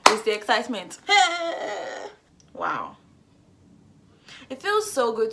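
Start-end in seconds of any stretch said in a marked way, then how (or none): 1.0-2.1 loud burst of laughter; big amused laugh. Real, not acted. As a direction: laugh out at yourself. Laughs at own accent.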